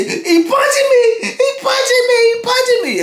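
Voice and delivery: high tearful shouts, he punching me; imitated crying plea. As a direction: mimic a beaten man wailing. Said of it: "he say".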